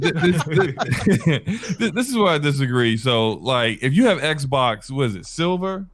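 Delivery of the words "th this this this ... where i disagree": laughing through a restart of the line; real laughter breaking up the words. Real, not acted. Cohost hyped him before he disagrees, laughing.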